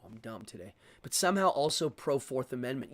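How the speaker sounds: ordinary delivery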